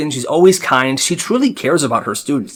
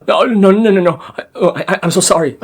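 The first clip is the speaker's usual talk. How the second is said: mock terrified apology; panicked pleading; 5 semitones higher, faster than usual.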